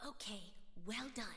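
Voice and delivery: usual speaking voice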